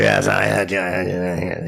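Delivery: weird little troll muttering; trollish voice. Retold or imitated. Guitarist brushes off a fan, weird trollish voice. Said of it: "that little weird trollish voice".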